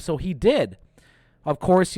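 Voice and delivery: normal talking voice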